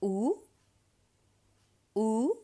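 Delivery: "Ou" as a long, clear oo; oo vowel demo. Shown as a demonstration. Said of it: "oo sound".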